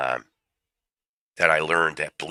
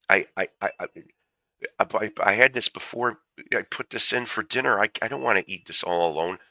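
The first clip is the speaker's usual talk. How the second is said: higher, stammering fake-tearful widow; mock weeping widow; 6 semitones higher than usual. Retelling the TV widow to the police, mock weeping. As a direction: ham up a grieving wife's blubbering. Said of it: "she's bawling her eyes out".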